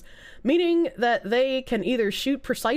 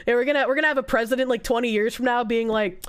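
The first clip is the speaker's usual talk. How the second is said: normal talking voice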